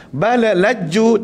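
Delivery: balalaju said slowly, lam bounced; wrong reading. Shown as a demonstration. Teacher demonstrates the bounced-lam mistake. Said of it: "tak matikan lam".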